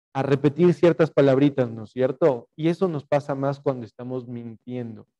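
ordinary talk

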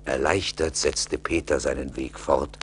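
normal talking voice

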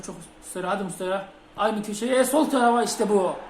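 usual speaking voice

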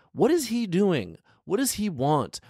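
usual speaking voice

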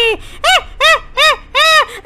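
high, slow, separated cackles; evil ghost cackle. Acted. Ghost lady gloats after threatening Franklin, evil cackle. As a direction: cackle menacingly in loud, spaced bursts. Ghost lady's menacing noise.

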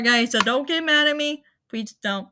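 usual speaking voice

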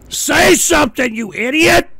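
high, loud yell into the dead line; frustrated shout. Real, not acted. Host shouting at a caller who won't speak, in a frustrated yell. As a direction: bark at the silent caller to talk.

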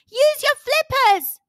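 loud, high, slow shout. Acted. Rossi shouting to Gasper as she dives, loud and high. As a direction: call out to him in the water. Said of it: "shouted Rossi".

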